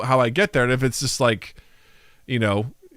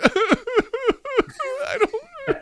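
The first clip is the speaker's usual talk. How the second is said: high, laughing, whimpering protest; dreading toilet; 3 dB quieter than usual. Pretend. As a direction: whimper in terror.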